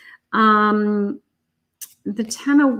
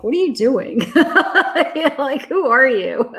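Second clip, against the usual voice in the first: laughing mock disbelief; 4 semitones higher than usual. Psychic acting out the client's reaction to old-fashioned manners, laughing. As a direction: imitate a baffled reaction through laughter.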